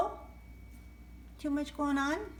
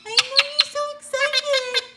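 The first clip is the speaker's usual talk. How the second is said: higher, louder, beaming at the bird; excited high coo; 14 semitones higher, 10 dB louder than usual. Real, not acted. Owner gushes at her excited parrot, high and loud. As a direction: gush with excitement.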